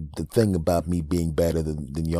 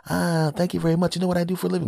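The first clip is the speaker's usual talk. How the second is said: high-pitched lady voice; new wife chatting, higher; 11 semitones higher, faster than usual. Retold or imitated. New wife telling what she does, high voice. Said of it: "she's gonna go".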